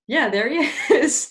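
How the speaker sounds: amused reveal with a chuckle; laughing Oberon. Retold or imitated. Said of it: "Oberon's like".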